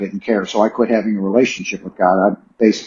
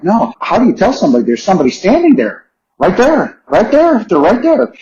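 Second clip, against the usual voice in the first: higher, quicker, urgent retold protest; insistent plea; 8 semitones higher than usual. Patient pleading with nurses about a presence, insistent. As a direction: press the point, pitch jumping up. Acting out his unheard frantic plea.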